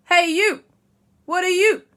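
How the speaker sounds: loud, high-pitched New York challenge; New York accent. Acted. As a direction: confront a stranger in a bit of a New York accent. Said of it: "now he's gone a little bit New York".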